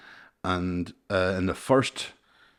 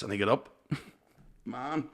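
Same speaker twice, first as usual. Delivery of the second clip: ordinary delivery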